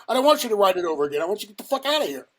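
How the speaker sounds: fast, high, loud imitation of angry boss; retold furious rapid-fire dismissal; yelling. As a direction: cut off the apology and throw them out. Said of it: "He's yelling at me".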